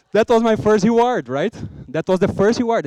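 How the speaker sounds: loud, high, excited declaration; triumphant. Real, not acted. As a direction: proclaim it like a win. Triumph after the driver's compliment.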